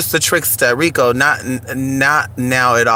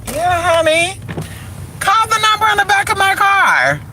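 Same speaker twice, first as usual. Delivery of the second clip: much higher, thin falsetto read; high squeaky take. Shown as a demonstration. Showing the car line multiple ways, squeaky falsetto. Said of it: "I can say it multiple ways".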